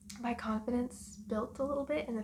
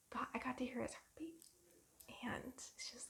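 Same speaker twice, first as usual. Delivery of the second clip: very soft, near tears; hushed, catching on emotion; welling up; 11 dB quieter than usual. Real, not acted. Mother recalling her baby's heartbeat, welling up. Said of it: "I don't wanna cry".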